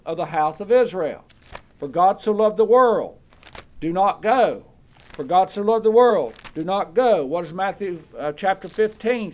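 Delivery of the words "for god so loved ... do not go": loud, forceful preacher's contrast; emphatic preaching. Acted. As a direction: hammer the two verses against each other. Preaching, contrasting two verses.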